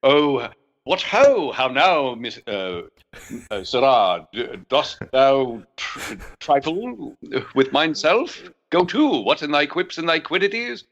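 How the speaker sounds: slow, hammed-up Elizabethan accent; theatrical Elizabethan accent, playfully overdone. Shown as a demonstration. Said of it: "we need you to share".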